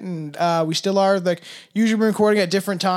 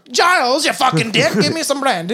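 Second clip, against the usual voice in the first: higher, louder rude lord berating his butler; rude master to butler; 6 semitones higher, 5 dB louder than usual. Pretend. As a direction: bark at the butler for brandy, pitched up. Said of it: "it reminds me of, like, a butler".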